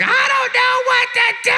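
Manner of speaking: very high, loud mock bawling; hammed-up sobbing girl; tearful student. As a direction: wail helplessly through fake tears. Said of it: "and she's crying".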